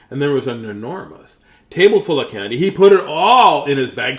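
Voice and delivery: plain reading voice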